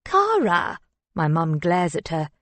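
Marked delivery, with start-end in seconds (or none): 0.1-0.8 scolding. A narrator's reading. Mum scolds daughter.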